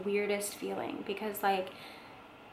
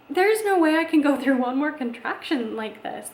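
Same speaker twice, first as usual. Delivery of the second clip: loud, pleading and higher; begging to be spared one more push; desperate plea; 6 semitones higher, 7 dB louder than usual. Retold or imitated. Exhausted mother in labour tells the nurse, desperate loud plea.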